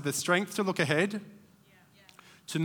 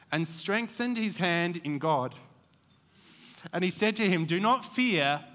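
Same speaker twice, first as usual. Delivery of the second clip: scripture reading; 2 semitones higher than usual. Acted. Preacher reading Jonathan's words to the congregation, reverent. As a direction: give Jonathan's promise gently.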